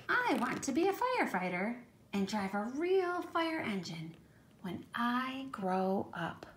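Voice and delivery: storybook read-aloud voice; reading to children. Acted. Reads child narrator's lines.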